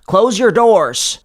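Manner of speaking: high, loud commanding shout; screamed order. Acted. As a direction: order the villagers inside. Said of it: "Father Tuft screamed".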